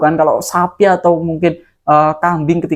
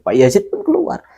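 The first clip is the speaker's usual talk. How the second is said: plain reading voice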